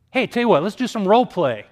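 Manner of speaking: selling the idea with a burst of energy; eager pitch. Retold or imitated.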